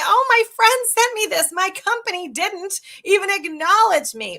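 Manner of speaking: high, loud put-on voice of a thrilled employee; gushing employee. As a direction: play the delighted friend posting online. Acted quote of a recruit's post.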